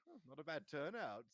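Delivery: mildly puffed-up ghost host; proud. A narrator's reading. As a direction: say it with a touch of pride. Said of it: "nearly headless Nick proudly".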